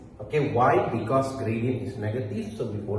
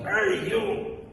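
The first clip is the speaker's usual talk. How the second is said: mock student shout; 7 semitones higher than usual. Students in class catching the teacher's mistake, mock shouting. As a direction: call out in pretend protest. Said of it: "like, shouting".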